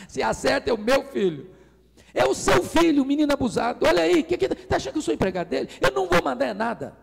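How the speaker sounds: shrill-pitched furious father shouting; furious rant. Retold or imitated. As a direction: blow up in outrage. Father ranting, voice raised.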